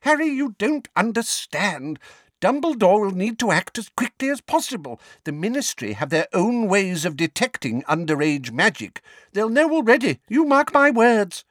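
put-on high, agitated old neighbour; urgent Mrs Figg. Neighbour warning Harry about the Ministry, urgent.

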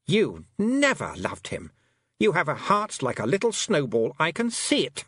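high, lilting, scornful queen voice; haughty fairy queen. A narrator's reading. A fairy queen scorning the girl who wants her brother back.

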